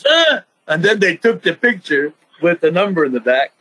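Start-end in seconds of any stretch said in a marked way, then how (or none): 0.0-0.4 sudden very high startled huh; surprised. Retold or imitated. Wrestler replays his shocked reaction to the taunt, high startled huh. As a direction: jolt up, caught off guard.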